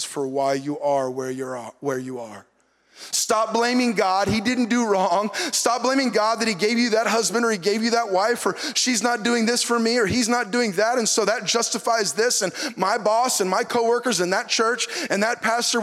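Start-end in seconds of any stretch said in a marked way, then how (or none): none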